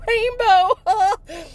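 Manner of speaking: high, loud choked-up call; tearful call. Retold or imitated. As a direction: call out through tears. Calling to passing homeless people.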